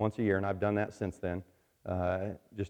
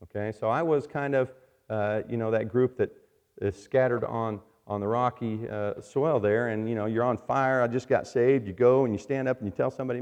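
ordinary talk, nothing marked

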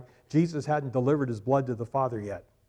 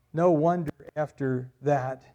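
usual speaking voice

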